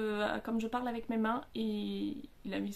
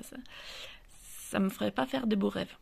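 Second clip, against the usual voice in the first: ordinary talk, nothing marked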